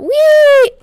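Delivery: high, loud gleeful cry; gleeful whee. Real, not acted. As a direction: squeal it like a rollercoaster drop. Jumps down from height.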